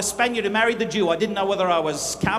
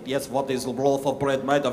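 much lower, deep puzzled voice; puzzled soldier; 9 semitones lower, 3 dB quieter than usual. Pretend. The other soldier asks about the bread, deep and baffled. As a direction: drop way down to a baffled grunt. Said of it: "And he said".

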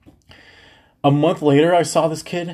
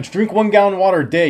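brisk, louder coach command, fast; coach ordering; 6 semitones higher, 4 dB louder, faster than usual. Retold or imitated. Coach telling the acne kid the cure, brisk and loud. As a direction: snap out the order. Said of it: "He's like".

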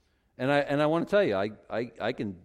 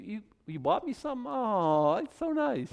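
mock gushing; 10 semitones higher than usual. Mock gushing over a gift.

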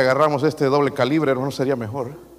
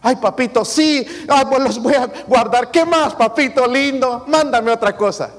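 much higher, louder, syrupy mock-eager kid; sugary obedient child; 10 semitones higher, 6 dB louder, slower than usual. Child answering father about shoes, mock-sweet and eager. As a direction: ham up an impossibly sweet child. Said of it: "cuando usted le dice".

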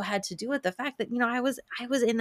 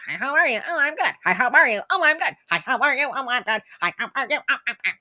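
autopilot chatter; 5 semitones higher, 4 dB louder, faster than usual. Retold or imitated. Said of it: "autopilot of".

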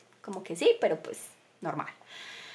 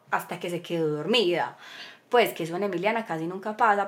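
normal talking voice

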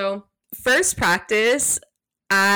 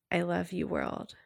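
very soft, inward declaration of love; quiet affirmation from the womb; 10 dB quieter than usual. Shown as a demonstration. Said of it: "And then saying".

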